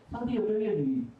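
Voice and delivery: ordinary talk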